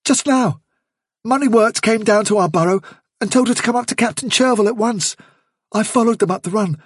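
high-pitched, worried female rabbit voice; anxious doe. Acted. Hyzenthlay telling Bigwig of the arrest, anxious. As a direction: pour out the bad news, pitched well up.

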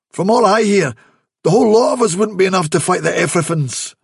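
quick eager rabbit voice; Dandelion's eager question. Acted. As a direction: rush the question out.

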